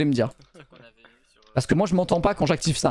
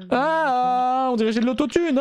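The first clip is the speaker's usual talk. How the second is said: higher autotuned voice; autotune voice; 7 semitones higher, slower than usual. Shown as a demonstration. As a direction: talk through an autotune filter.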